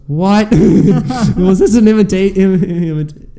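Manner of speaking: high, loud and slow astonished question; mock disbelief, stumbling over the word; stunned catcher. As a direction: play the catcher who can't believe the strikeout. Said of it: "his catcher goes".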